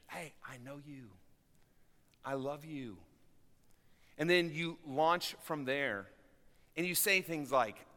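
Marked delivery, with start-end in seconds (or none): 0.1-3.0 much softer, gentle fatherly reassurance; dad softly telling his child he loves them; tender reassurance. Retold or imitated. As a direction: lower and hushed, like a dad comforting his kid.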